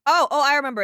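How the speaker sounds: loud, high burst of recognition; excited realization. Real, not acted. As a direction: blurt it out as it clicks. Suddenly recalls the location.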